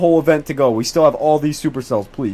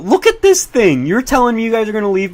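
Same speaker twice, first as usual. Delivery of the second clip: excited; 7 semitones higher than usual. Real, not acted. Excited at tornado on stream.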